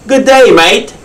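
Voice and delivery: high-pitched Australian greeting; cheery hammed-up Australian hello; Australian accent. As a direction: call it out in a put-on Aussie accent. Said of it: "way of greeting somebody in Australia".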